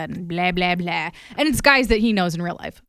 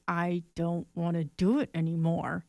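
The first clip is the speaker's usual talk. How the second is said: fed-up quiet warning; 7 dB quieter than usual. Retold or imitated. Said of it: "gave him a look and was like".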